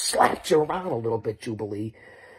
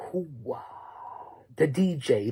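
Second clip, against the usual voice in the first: ordinary talk, nothing marked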